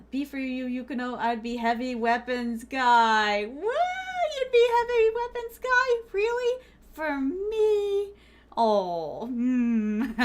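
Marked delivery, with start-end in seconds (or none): 3.5-8.1 high, loud astonished squeal at the suggestion; shocked and delighted, pitch way up; surprised. Real, not acted. Streamer squeals at a chat suggestion, high and surprised.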